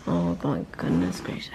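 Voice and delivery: real whisper; hushed whisper. Real, not acted. Speaker trying not to wake the sleepers, whispering. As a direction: keep it to a whisper. Sleepers nearby, just woken.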